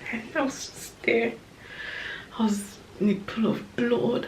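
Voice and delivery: fighting back tears, barely voicing it; quiet tearful voice. Real, not acted. Mother remembering the birth, crying quietly to viewers. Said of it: "I don't even know why I'm crying".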